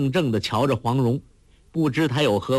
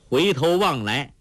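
ordinary delivery, nothing marked

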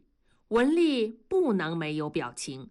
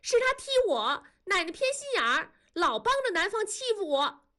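put-on high, fast indignant little girl; defiant child; 13 semitones higher, faster than usual. A narrator's reading. Girl arguing back at grandma, high and defiant. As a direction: talk back to grandma, sure you're right. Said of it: "理直气壮地跟奶奶吵着说".

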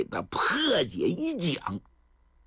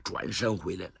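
ordinary delivery, nothing marked

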